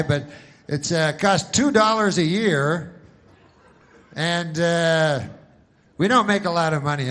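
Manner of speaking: loud, hammed-up TV pitchman for old folks; cheesy insurance pitchman. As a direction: sell it big like a late-night commercial. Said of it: "so it's always like".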